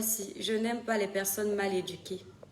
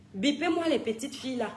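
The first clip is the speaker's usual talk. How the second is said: normal talking voice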